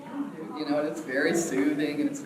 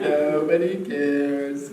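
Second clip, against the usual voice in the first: very slow, loud mournful drone; sluggish, self-pitying cartoon donkey; Eeyore; 3 semitones lower, 5 dB louder, much slower than usual. Shown as a demonstration. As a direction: drag out every word in gloom. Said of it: "you know, like that".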